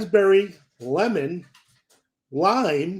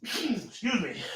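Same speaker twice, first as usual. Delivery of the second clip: sniffling; 6 semitones higher, 8 dB quieter, much slower than usual. Real, not acted. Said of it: "I keep sniffling it".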